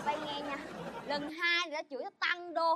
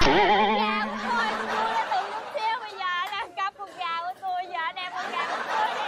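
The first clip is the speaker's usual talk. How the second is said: wailing lament; 3 dB louder than usual. Acted. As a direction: bewail the loss to the whole neighbourhood. Wailing over her stolen chicken. (than khóc kể lể)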